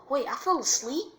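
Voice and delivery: high, loud disbelief; startled high-pitched question; astonished. Acted. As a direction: blurt it out, shocked. Naruto learns he was asleep.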